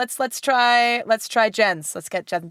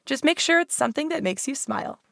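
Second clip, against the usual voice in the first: ordinary talk, nothing marked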